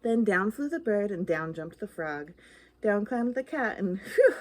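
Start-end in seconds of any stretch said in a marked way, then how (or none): 4.0-4.3 high loud sigh of relief; relieved phew. Acted. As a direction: blow out a big phew of relief. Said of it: "said the dog".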